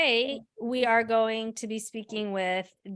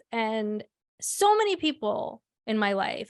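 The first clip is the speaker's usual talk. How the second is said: ordinary talk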